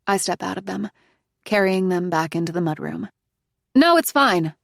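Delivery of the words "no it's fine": 1 brushing it off. Acted. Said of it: "I say".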